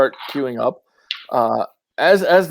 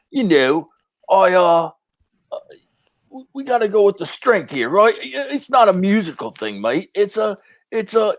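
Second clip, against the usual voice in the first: higher pitched fake British accent from the frontman; a front man faking a tough accent; put-on mate accent; 7 semitones higher than usual. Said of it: "that's not even your accent".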